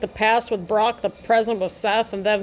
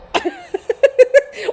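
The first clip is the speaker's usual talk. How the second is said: a bright, high burst of real laughter; jovial laugh; 14 semitones higher, 5 dB louder than usual. Real, not acted. Watching wrestling, she bursts into jovial high laughter. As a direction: laugh out loud, delighted at the screen. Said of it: "Why did I sound so jovial?".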